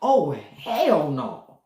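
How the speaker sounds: slow, drawn-out "Oh, hell no"; horrified old man. Retold or imitated. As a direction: recoil like the old man in the movie. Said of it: "shot out ... that bed like".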